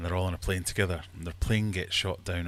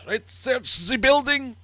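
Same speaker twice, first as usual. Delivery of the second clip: very high, loud, drawn-out order; hammed-up officer yelling a command; shouting SS commander; 10 dB louder, slower than usual. SS commander ordering his men to search, shouted high. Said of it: "the S S commander comes in and says".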